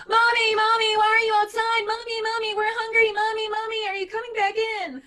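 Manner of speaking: put-on high, loud whiny children calling; screaming kids. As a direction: whine like little kids wanting mom. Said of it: "my three little monsters would be screaming".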